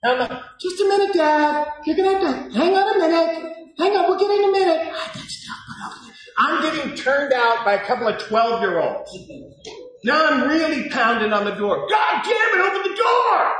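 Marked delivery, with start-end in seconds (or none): 0.6-4.8 put-on high-pitched kid voice; mock-innocent high voice; imitated stalling kids. 4.9-6.2 hushed stage whisper; secretive imitated whisper; frantic kids' whisper. Kids whispering. 11.9-13.5 high, furious reenacted shout; full-throated acted fury; screaming rage. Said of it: "just screaming, rage, full of rage".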